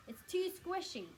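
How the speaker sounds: chewing while complaining; mouth full. Acted. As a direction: speak through a mouthful of food. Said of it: "talking with his mouth full".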